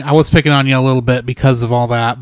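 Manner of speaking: usual speaking voice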